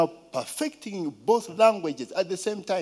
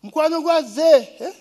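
calling out; 9 semitones higher, 6 dB louder than usual. Retold or imitated. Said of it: "They say".

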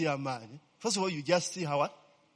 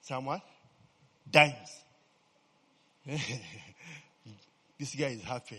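ordinary talk, nothing marked

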